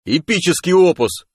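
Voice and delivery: high, loud barked shout; рявканье. A narrator's reading. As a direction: bark it sharply at the pair. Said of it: "рявкнул он Фиру с подругой". (громкий резкий окрик)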